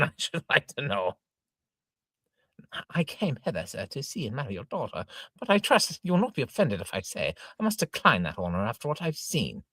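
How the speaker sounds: normal talking voice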